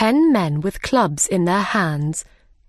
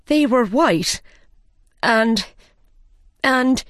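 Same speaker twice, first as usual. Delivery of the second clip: higher, halting explanation; nervous faltering; 4 semitones higher, slower than usual. A narrator's reading. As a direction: falter and stall as a frightened gardener. Scared gardener falters explaining.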